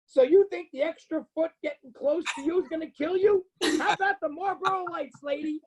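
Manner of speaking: high mocking voice, dripping sarcasm; mock-sweet jab at the lady; sarcastic retort. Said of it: "I go like this, I go".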